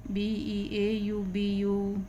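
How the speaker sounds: letters spelled one by one, slowly; slow spelling aloud. Shown as a demonstration. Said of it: "ये है आपका beautiful".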